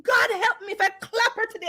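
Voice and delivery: half shout it, near crying; upset outcry. Real, not acted. Woman retelling her clap-back, upset outcry. Recounting outrage, near tears.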